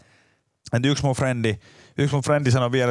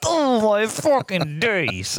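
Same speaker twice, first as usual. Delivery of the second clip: very slow, higher, drawn-out dismay; 8 semitones higher, much slower than usual. Retold or imitated. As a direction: stretch out disbelief. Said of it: "Mä oon sillä".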